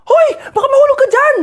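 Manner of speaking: high, loud warning yell; alarmed shout. Acted. Teacher warning a student who may fall, alarmed shout.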